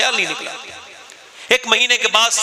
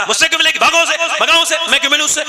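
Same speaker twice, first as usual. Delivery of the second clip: higher, sharp scolding of the messenger; 6 semitones higher, much faster than usual. Acted. Moses scolds the messenger about the woman, sharp rebuke. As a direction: shoo the man away with a rebuke. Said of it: "हजरत मूसा डांटा".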